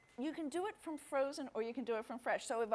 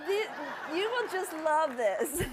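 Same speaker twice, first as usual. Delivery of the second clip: laughing; 4 semitones higher, 6 dB louder, slower than usual. Real, not acted. Host grabs the treat.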